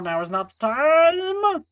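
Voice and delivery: high, loud, drawn-out protest; exasperated mock cry. Streamer scolding Alphino for interrupting, high exasperated wail. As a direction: wail it, stretching the last word.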